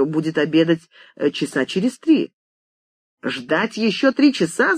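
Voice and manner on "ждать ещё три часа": mildly yelled, higher question; indignant yell. A narrator's reading. Writer hears he must wait three hours, indignant. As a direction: cry out in disbelief. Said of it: "заорал тот".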